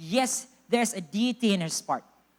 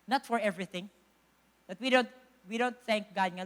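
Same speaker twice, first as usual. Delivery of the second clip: ordinary delivery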